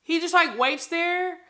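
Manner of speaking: ordinary talk, nothing marked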